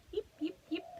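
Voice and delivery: high little fox yips; yipping fox. Pretend. Reading to a child, fox yips high. Fox noise in bedtime book.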